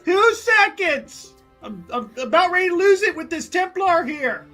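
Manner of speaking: high, strained call back; exasperated calling up. Acted. Visitor fed up with Templar calls up, exasperated. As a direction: holler up, about to lose patience.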